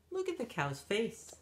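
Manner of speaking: usual speaking voice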